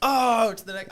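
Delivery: loud, high cry of realization; real surprised recognition. Real, not acted. Hearing the answer to the guessing game, surprised recognition. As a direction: burst out as it suddenly clicks. Suddenly recognizes the famous book.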